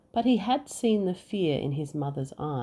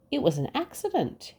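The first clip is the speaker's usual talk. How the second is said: hurried protest; 9 semitones higher, faster than usual. Acted. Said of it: "he roared".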